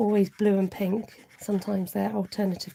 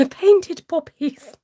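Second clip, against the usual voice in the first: laughing; 8 semitones higher, 6 dB louder, much slower than usual. Real, not acted. Laughs at own repeated stumble.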